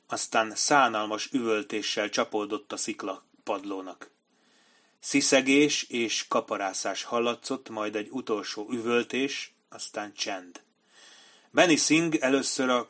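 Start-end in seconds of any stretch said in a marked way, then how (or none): none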